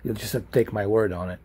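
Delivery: ordinary delivery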